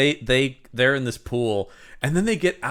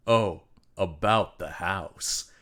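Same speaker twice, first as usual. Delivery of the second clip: slow quip; 6 semitones lower, 5 dB quieter, much slower than usual. Retold or imitated. Last movie line slow.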